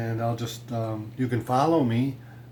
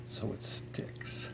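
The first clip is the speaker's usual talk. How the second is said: whispered; 12 dB quieter, much slower than usual. Real, not acted. Carefully pressing a decal down.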